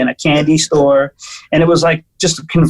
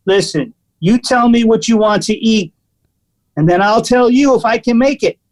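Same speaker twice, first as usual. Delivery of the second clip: higher mock bossy waiter laying down the rules; aggressive waiter; 5 semitones higher than usual. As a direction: lecture the customer like a tough waiter, laughing at the end. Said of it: "Really super aggressive".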